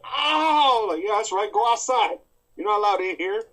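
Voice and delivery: loud, high scolding shout; playful stern homeowner yelling; mock scolding. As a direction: shoo the intruder out, loudly. Acting out shooing someone away.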